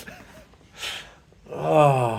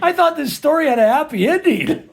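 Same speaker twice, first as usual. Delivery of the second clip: high, louder, laughing protest; dismayed surprise; 6 dB louder than usual. Real, not acted. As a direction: complain in mock dismay. Reacting to kittens being drowned.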